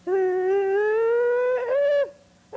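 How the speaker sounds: very high, loud Tarzan yell; jungle yell. Retold or imitated. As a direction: swing through the trees yelling. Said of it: "George of the Jungle".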